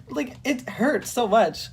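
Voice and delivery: ordinary delivery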